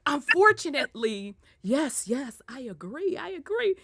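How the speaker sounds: hushed, prim imitation of mom; real proper mom. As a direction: agree politely, putting on proper airs. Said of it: "They get real proper".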